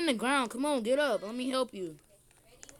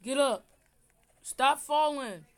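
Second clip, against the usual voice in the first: normal talking voice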